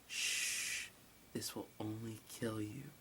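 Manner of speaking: soft hushed threat; creepy whispered hush; menacing whisper. Acted. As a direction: whisper it close to the ear. Beast hushing the victim.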